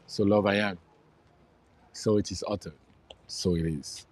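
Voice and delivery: low, measured mantra recitation; mantra reading. Acted. Man reads "I am love" to his audience, low mantra recitation. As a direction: recite it with pauses, letting each phrase settle.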